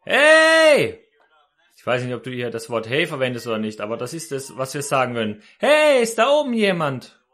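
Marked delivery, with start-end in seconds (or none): 0.0-1.0 loud, high cry of "hey"; shouting for help. Retold or imitated. Man hanging from a bush screams for help, shouting. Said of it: "schrie er". 5.6-7.2 high, loud shout upward; calling for help. Retold or imitated. Hanging man calls up the cliff for someone, shouting. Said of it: "schrie er".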